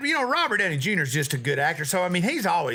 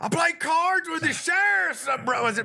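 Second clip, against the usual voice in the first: impression of movie character; 10 semitones higher than usual. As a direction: play the sheriff-dropping braggart in a high put-on voice. Quoting Sling Blade character.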